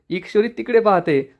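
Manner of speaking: ordinary delivery, nothing marked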